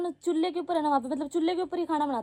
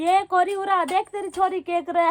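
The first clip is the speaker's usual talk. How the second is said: aunt calling mother, laughing; 5 semitones higher, 5 dB louder than usual. Retold or imitated. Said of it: "mummy को प्यार से कोरी बोलते हैं कि".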